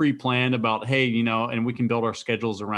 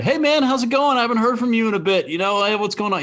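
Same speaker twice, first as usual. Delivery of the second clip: much higher, louder, upbeat greeting; cheery phone hello; 13 semitones higher, 5 dB louder, faster than usual. Acted. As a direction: greet an old friend on the phone. Acting out a friendly phone call.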